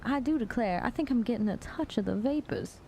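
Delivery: Southern belle accent, mock-faint. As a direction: play a swooning Southern belle. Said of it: "Anything else that they say?".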